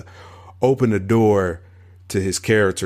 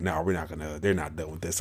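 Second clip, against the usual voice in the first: quiet quote; 4 semitones lower, 11 dB quieter, faster than usual. Retold or imitated. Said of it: "and you were like".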